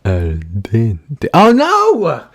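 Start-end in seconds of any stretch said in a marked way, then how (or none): 1.3-2.2 excited. Acted. Reacting to al dente.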